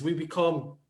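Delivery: usual speaking voice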